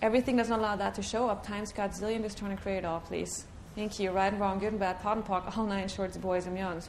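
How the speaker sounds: quick, hushed ritual recitation; softly rushed chanted formula; rattled-off clearing statement. Acted. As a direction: murmur the formula fast like a rote incantation.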